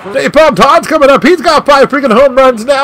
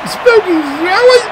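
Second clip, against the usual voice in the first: excited call; 8 semitones higher than usual. Real, not acted. Excited live play-by-play.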